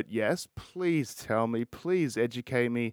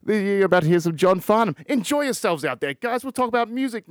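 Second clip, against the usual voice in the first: louder, higher host sign-off voice; upbeat sign-off; 7 semitones higher, 6 dB louder than usual. Acted. Podcast host signing off to listeners, upbeat announcer voice. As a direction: pump them up for the outro song.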